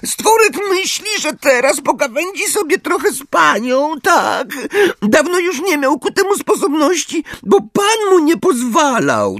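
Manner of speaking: very high, whiny creature voice; house-elf voice. A narrator's reading. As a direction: ramble on as a squeaky elf. Elf mutters on, ignoring Harry. (bardzo wysoki, piskliwy głos skrzata)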